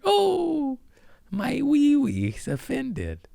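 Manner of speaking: very high, slow mocking whine; mock offended whining. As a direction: mimic a wounded crybaby in a squeaky high voice. Mocks offended listeners as pussies.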